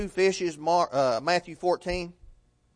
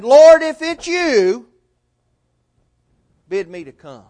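calling out; 3 semitones higher, 8 dB louder than usual. Retold or imitated. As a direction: call out to the Lord from the boat. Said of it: "called out and he said".

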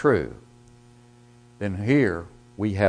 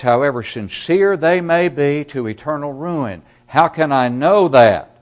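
loud, high, impassioned pulpit delivery; preaching at full force; 8 semitones higher, 11 dB louder than usual. Acted. Preacher warning his congregation of eternal ruin, full-force preaching.

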